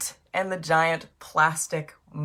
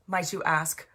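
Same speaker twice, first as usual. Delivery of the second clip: ordinary delivery, nothing marked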